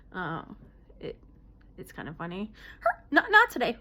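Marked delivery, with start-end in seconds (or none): 3.1-3.8 loud, high-pitched sudden tic; vocal tic. Real, not acted. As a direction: blurt it out involuntarily, high and loud. Vocal tic in Tourette's talk.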